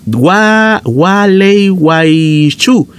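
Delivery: Gualeguaychú drawn out very slowly after a false start; careful pronunciation. Shown as a demonstration. Host preparing to say a listener's hometown, slow and deliberate. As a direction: brace yourself, then spell out Gualeguaychú slowly. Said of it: "Déjame preparar".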